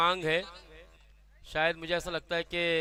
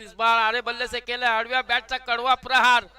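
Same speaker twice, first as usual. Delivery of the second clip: excited; 4 semitones higher, 7 dB louder than usual. Real, not acted. Big shot called.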